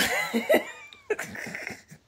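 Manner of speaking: a high, squealing burst of laughter. Real, not acted. Laughing at her own harsh thoughts about him. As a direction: let a high giggle burst out.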